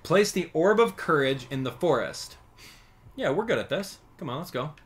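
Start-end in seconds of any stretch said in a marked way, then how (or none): none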